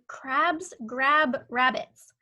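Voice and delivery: put-on slow, word-by-word reading for a child; slow read-aloud. Shown as a demonstration. Teacher reading a sentence slowly to young pupils, slow and clear. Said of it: "Okay, Sal is saying".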